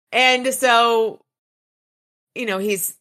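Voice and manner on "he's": ordinary delivery, nothing marked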